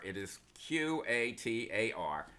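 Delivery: ordinary delivery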